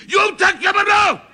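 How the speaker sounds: polite phrase yelled high and loud; furious shouted courtesy. Acted. Man drilling phone manners into a child, shouting angrily. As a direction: scream the courteous reply in exasperation. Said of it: "jag lärde dig att du ska fråga".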